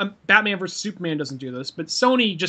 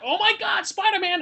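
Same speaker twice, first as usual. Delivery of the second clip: high-pitched gushing fanboy imitation; mock fan excitement; 13 semitones higher, 3 dB quieter than usual. Mimicking people thrilled by Spider-Man, high mock excitement. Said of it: "I'm not one of those people like".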